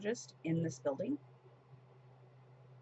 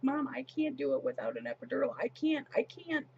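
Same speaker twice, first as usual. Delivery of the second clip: imitating a panicked daughter, pitched up; high pleading, a touch of desperation; retold tearful panic; 8 semitones higher than usual. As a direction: act out her helpless protest without real tears. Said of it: "she just started crying".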